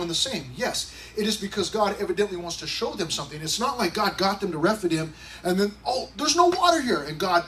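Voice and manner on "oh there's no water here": high, hammed-up surprised gasp; mock surprise. Pastor mocking the idea of a surprised God, high mock surprise. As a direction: play God as comically caught off guard.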